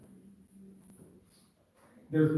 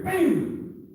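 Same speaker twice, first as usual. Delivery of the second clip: sharp shout; 12 semitones higher, 5 dB louder than usual. Shown as a demonstration. Demonstrating a strike on instructor.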